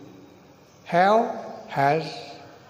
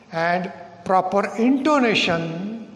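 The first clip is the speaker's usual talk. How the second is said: ordinary delivery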